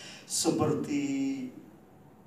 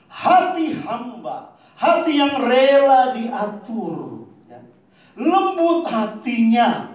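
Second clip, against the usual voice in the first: loud, slow, higher pulpit voice; louder preacher cadence, words drawn out; full-force preaching; 7 semitones higher, 12 dB louder than usual. Acted. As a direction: drive each phrase home to the congregation.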